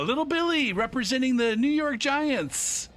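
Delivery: high, slow stadium announcer call. Retold or imitated. Mimicking the stadium announcer introducing each kid, announcer voice. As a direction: boom it out like the PA announcer. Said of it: "they were like".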